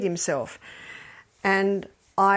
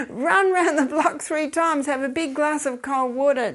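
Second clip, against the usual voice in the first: laughing advice; 6 semitones higher, 4 dB louder than usual. Real, not acted. Laughing urgent advice after joke.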